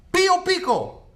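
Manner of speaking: high, loud disbelieving echo of the name; stunned double take; incredulous. Retold or imitated. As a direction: repeat the name like you can't believe it.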